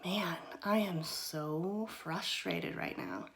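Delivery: slow whispered admission of frustration; soft, slow whispered demo of calm self-talk; calm self-acknowledgment. Shown as a demonstration. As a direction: say it slowly under your breath, just naming the feeling. Modelling kinder self-talk.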